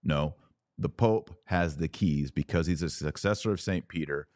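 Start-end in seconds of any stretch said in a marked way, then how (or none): none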